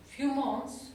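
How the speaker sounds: ordinary talk, nothing marked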